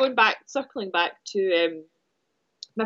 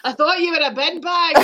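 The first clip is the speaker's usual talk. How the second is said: higher-pitched mock Glasgow accent; 6 semitones higher, 3 dB louder than usual. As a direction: act out the driver in a Glasgow accent. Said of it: "I can't do a Glasgow accent".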